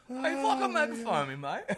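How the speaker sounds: loud, high mock English outrage; hammed-up mad Englishman with English accent; angry English accent. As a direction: imitate an offended English bloke. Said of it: "made this English guy really mad".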